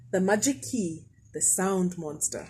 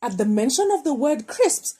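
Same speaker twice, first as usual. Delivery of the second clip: usual speaking voice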